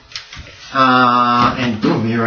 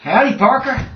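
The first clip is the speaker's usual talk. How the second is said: outlaw voice; 11 semitones higher than usual. Acted. GM voices Sutter.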